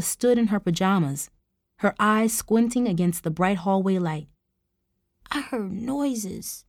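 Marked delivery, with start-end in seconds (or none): 5.3-6.6 put-on very slow, soft, high child; sleepy scratchy child. A narrator's reading. Sleepy child tells aunt what woke her, soft drowsy voice. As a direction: drag it out, half asleep. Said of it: "still scratchy from sleep".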